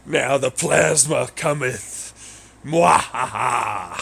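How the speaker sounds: slow, villainous boast and cackle; mad scientist. Pretend.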